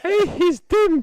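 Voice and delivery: high, drawn-out mock wail; mock doom-monger.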